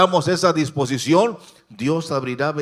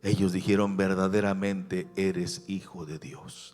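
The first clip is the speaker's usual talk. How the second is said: soft, lowered voice inviting prayer; hushed altar call; 6 semitones lower, 11 dB quieter than usual. Real, not acted. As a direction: draw the congregation gently into prayer, nearly whispering at times. Hushed call to prayer closing the sermon. (llamado a orar, en voz baja e íntima)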